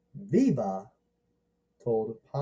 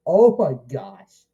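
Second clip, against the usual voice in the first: loud burst of oh my gosh; playful loud reaction; excited gasp; 2 semitones higher, 10 dB louder than usual. Retold or imitated. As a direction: blurt it out, thrilled. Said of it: "And I'm like".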